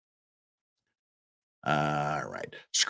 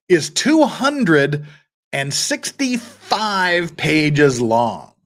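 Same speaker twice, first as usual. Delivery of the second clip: very slow, each word weighed; slowed for dramatic emphasis; slow emphatic; 3 semitones higher, much slower than usual. Real, not acted. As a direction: spell out how long it is, slowly. Stressing length of motion.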